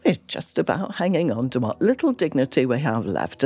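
ordinary delivery

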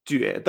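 juede as "jüé-de", rising then short neutral; segundo tono, neutro. Shown as a demonstration. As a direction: juede with French U, rising first syllable. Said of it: "Segundo tono y después el tono neutro". (juede con Ü, tono ascendente y neutro)

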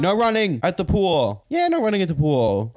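half-hearted pool-rule yell; mock yell. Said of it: "you gotta yell".

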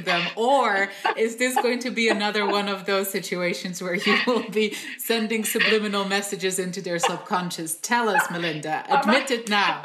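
laughing, hammed-up demand for a confession; mock interrogation. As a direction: grill her playfully, laughing as you raise your voice. Playful interrogation of the guest, laughing.

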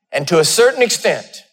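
ordinary delivery

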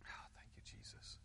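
whispered prayer of thanks. Real, not acted. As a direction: breathe a quiet thank you. Private prayerful aside.

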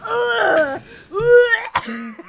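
high, crying groan of disgust; hammed-up pained ugh; mock disgusted groan. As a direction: whimper in revulsion. [name] recoils in disgust.

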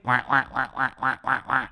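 a silly trombone wah wah wah; mock wah-wah noise. Host mocks his own joke with a wah-wah noise. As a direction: sing a sad-trombone wah. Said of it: "See what I did there?".

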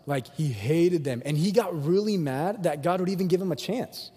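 ordinary talk, nothing marked

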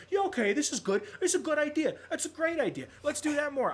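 mock cheerful approval, pitched high; sarcastic sing-song yes-man. Mocking pretend approval.

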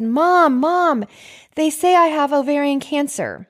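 high, distressed plea to mom; urgent high-pitched cry for mom; retold panic. Retold panicked phone call to mom.

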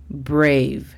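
"brave" said clearly, long ei vowel; careful English "brave"; brave model. Shown as a demonstration. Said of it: "La pronunciación fonética es".

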